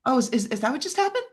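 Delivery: rushed and stammering, then a high "oh, okay"; mock dawning realization. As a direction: act out catching on far too late. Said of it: "You're like".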